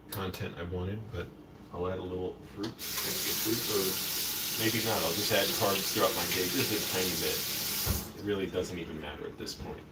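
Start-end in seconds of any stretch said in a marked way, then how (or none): none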